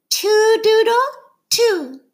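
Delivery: put-on high, loud little-girl counting; bright high toddler counting. A narrator's reading. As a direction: sing it out like a tiny child teaching a dog. Little sister teaching dog to count.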